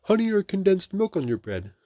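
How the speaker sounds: high, loud storybook voice; wrong character voice. A narrator's reading. Narrator voicing Rabbit's offer in the wrong voice. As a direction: ask in a high, loud bear-like voice. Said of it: "I did the wrong voice for that one".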